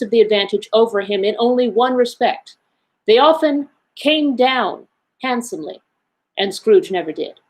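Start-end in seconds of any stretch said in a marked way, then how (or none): none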